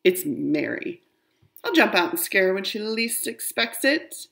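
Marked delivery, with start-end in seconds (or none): none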